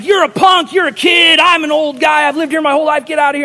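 high, loud shouted insults, imitated; angry tirade. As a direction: rant like a furious older neighbor. Neighbor shouts insults in quarrel.

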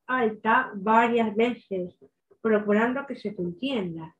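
talking round a pencil held between the teeth; obstructed articulation, striving to be understood; pencil in mouth. Shown as a demonstration. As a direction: speak with a pencil clamped crosswise in your mouth. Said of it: "Tienes que poner el lápiz en la boca".

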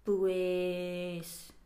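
ブエース drawn out slowly, hesitating; ブエース as a stalling filler; slow filler pues. Shown as a demonstration. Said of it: "って言っちゃうやつ".

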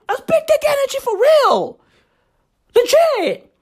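squeaky-high, strained hammed-up praise; high-pitched hype. Host hyping Trump's energy to listeners, high-pitched hype. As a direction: send the whole line way up high. Hyped praise, then repeated normally.

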